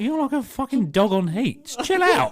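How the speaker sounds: mock exasperated shriek. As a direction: shriek it up high like you've lost patience. Mocking woman who won't calm down.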